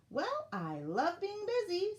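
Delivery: high, slow, upbeat elf voice; very positive. A narrator's reading. Little red elf keen to do the work, brightly positive. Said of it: "with the most positive of attitudes".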